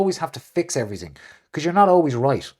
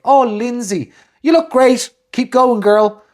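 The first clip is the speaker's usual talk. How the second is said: high, louder mock gush; gushing woman; 9 semitones higher, 7 dB louder, slower than usual. Woman cheering Lindsay on, high mock gush. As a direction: cheer her on in a hammed-up squeal. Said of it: "they'll be like".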